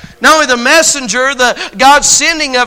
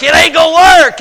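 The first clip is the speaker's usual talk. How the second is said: "It ain't gonna work" said higher and louder, hammering "gonna"; emphatic repeat; 5 semitones higher, 6 dB louder than usual. Shown as a demonstration. Preacher repeating his point to the congregation, louder and higher. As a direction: say it again and punch it home.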